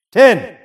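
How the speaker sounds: Ten punched high and loud; incredulous stress. Real, not acted. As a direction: hit Ten in disbelief. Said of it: "echoing the boast in disbelief".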